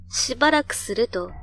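ordinary delivery

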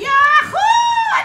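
loud, high whoop of victory; real gleeful shout; triumphant cheer. Real, not acted. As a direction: whoop like you just won the race. Celebrates a win in game.

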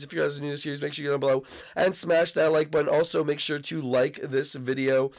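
usual speaking voice

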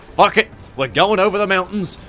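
loud, high mock battle cry; gung-ho Yankees. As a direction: charge in like reckless troops, hammed-up.

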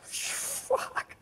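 high, breathy stunned curse; retold freaked-out gasp of a word. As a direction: let it out as an airy shocked expletive. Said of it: "I was so freaked out".

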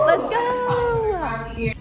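rallying cry before the workout; hype shout. Real, not acted. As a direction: fire yourself up. Hyping up for workout.

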